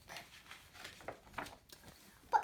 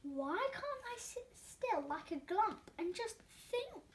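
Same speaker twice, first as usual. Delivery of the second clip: ordinary delivery, nothing marked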